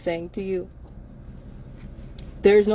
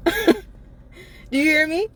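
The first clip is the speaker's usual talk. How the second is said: laughing; 7 semitones higher, much slower than usual. Real, not acted. Laughing plea to listener.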